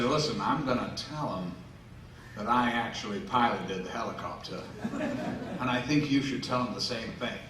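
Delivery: low-key, conspiratorial aside; confidential retold request. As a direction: quietly propose a fib, keeping it low.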